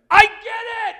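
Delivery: sudden joyful shout, high and loud; an ecstatic yell. Retold or imitated. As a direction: burst out with the realization. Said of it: "out loud, I just went".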